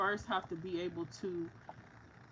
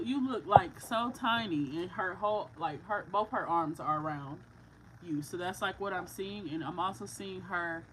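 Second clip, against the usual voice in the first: usual speaking voice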